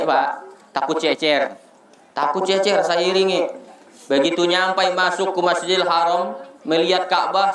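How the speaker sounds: ordinary delivery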